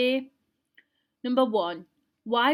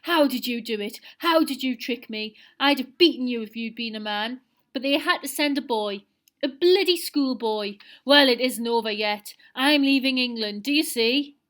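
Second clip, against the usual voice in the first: loud, bitter ranting, pitched up; furious ranting; 2 semitones higher, 7 dB louder than usual. A narrator's reading. Seal rages at the schoolboy who ruined his plans, furious and loud. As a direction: spit out every word of the defeat. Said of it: "Seal howled".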